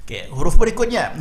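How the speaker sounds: ordinary delivery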